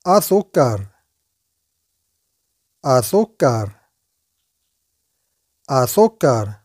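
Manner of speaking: أسكر said as azúcar, slow and clear; Spanish word drilled. Shown as a demonstration. Teacher to viewers, drilling azúcar clearly. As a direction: say أسكر carefully with a falling end. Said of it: "وهو سكر".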